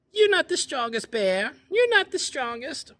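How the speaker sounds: very high, loud squeaky taunt; squeaky chipmunk. Acted. Chipmunk teasing Bear from her den in a very high squeak. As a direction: squeak it out cheekily.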